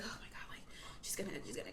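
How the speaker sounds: breathy whispered worry, retold; anxious hush. Daughter dreading her mother's reaction, retold anxious whisper. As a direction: whisper it like a private panic.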